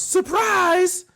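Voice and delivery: high-pitched "surprise" yell, mimicking an annoying announcer; high, gleeful mock shout. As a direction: imitate an annoying commentator yelling.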